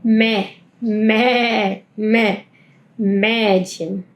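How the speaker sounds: "Me" with an open A mouth, then "Mädchen"; ä drill. Shown as a demonstration. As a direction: build "Mädchen" from the ä. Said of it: "estoy haciendo la boca de A".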